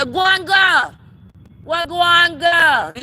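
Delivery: loud, high, drawn-out call; playful shout. Pretend. Spice shouting from the backyard, playful. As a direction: holler it across the backyard.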